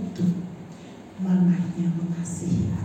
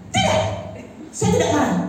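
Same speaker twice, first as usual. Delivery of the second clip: high-pitched protest, dropping at the end; husband insisting in a high voice; imitated insistent denial; 8 semitones higher, faster than usual. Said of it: "Siapa yang marah?". (meniru suami membantah)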